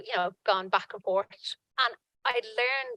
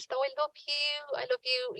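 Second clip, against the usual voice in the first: a hint of a weeping nun, pitched up; imitating a crying nun; 5 semitones higher, 4 dB quieter than usual. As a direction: mimic a nun saying goodbye through tears, higher. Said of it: "And the nun was crying".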